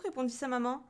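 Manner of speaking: plain reading voice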